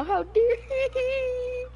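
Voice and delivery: slow, high, stretched mock offense; drawn-out fake indignation; mock outrage.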